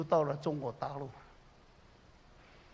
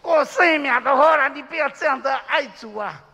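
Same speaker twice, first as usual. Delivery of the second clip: pleading doctor; 8 semitones higher, 11 dB louder than usual. Retold or imitated. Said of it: "但是医生说：".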